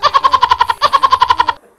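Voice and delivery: high burst of laughter; loud laugh. Real, not acted. Dad laughing at child's vlog.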